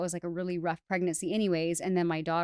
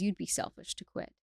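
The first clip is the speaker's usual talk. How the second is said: a lightly voiced calm inner voice, softer; 6 dB quieter than usual. Inner voice reassuring the tired mother, softly and calmly. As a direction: say it gently as the quiet voice within. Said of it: "And then this voice says".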